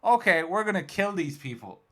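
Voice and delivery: high, loud hammed-up announcement; mock activist declaration. Activists escalating their plan, mock zealous declaration.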